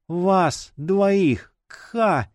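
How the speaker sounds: high, strained dying voice; effortful high words ending in a choke; squeezed out. A narrator's reading. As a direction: force out the last words, then cough. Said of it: "выдавила из себя Джина".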